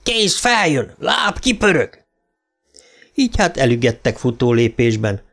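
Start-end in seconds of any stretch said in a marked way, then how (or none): 0.0-2.0 higher, bellowing drill commands; yelled drill orders. Acted. Sergeant yelling after the recruits, a higher bellowing drill command. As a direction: shout them into a run. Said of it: "Wilson meg utánuk üvölt".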